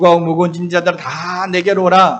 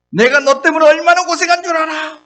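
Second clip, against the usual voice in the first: higher, louder hammed-up scolding; mock scolding shepherd; 11 semitones higher, 6 dB louder than usual. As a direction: play the fed-up shepherd yelling at the lost sheep. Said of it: "양을 막 야단치고 막 화를 냈습니까?". (양을 꾸짖는 목자, 과장된 호통)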